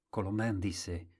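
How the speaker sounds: ordinary delivery, nothing marked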